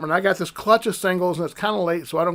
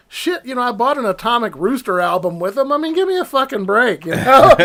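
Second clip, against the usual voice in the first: higher, exasperated retort ending in a laugh; indignant retort; 6 semitones higher, 6 dB louder than usual. Retold or imitated. Teen snapping back at a mocking record clerk, exasperated. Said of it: "And I was like".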